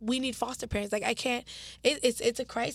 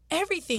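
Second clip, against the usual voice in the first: Everything said alone, higher and punched; stress on everything; 5 semitones higher, faster than usual. Real, not acted. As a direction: lift the word Everything up on its own. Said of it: "Everything on that list taken away".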